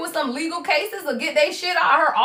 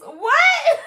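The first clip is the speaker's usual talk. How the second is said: a squeaky what that breaks into laughter; high disbelieving laugh. Real, not acted. Disbelief at the channel song, high laughing shriek.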